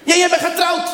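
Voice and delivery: very high, loud scolding cry; indignant shout. Retold or imitated. Claudia reminding the husband he is married, very high indignant shout. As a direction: shout him down, outraged.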